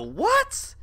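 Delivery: sudden squeaky high what; jolted by a scare, yelp it out; real surprised yelp; startled shriek. Real, not acted.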